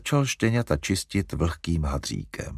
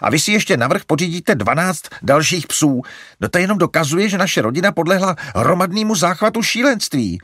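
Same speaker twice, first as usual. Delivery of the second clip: louder, higher annoyed; Larry working himself up; fuming; 6 semitones higher, 7 dB louder than usual. A narrator's reading. Larry ranting at mother about animals, fuming. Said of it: "čílil se Larry".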